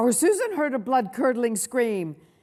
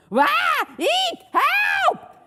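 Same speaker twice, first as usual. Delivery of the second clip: three high, loud shrieks; terrified screams; 7 dB louder, much slower than usual. A narrator's reading. Children screaming in the dark museum, panicked shrieks. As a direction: squeal and yell for help. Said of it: "the terrible screams".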